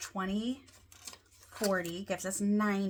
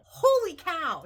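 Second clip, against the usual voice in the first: loud, much higher burst of amazement; excited exclaim; 10 semitones higher, 7 dB louder, slower than usual. Real, not acted. Vlogger shouting her joy at her savings to viewers. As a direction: let it burst out, thrilled. Shocked by the cash total.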